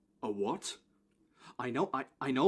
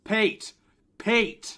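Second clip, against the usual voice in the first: loud raised call; 6 semitones higher, 5 dB louder than usual. Acted. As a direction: shout for him across the room. Calling out for Pete.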